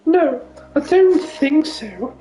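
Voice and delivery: high posh lady voice drifting to Newcastle; posh widow with a Newcastle slip; posh voice slipping Geordie. Pretend. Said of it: "she sounds like she's from Newcastle".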